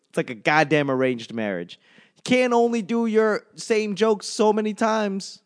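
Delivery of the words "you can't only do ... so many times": usual speaking voice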